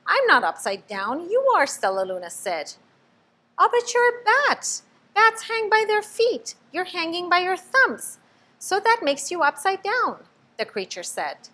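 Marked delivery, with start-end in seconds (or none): 0.1-1.6 higher, louder, insisting; indignant little bat. A narrator's reading. Stellaluna corrects the bat, high and insistent. Bats arguing who is upside down. 3.6-10.3 high, loud, explaining; know-it-all young bat. A narrator's reading. Bat explains bats to Stellaluna, high and lively.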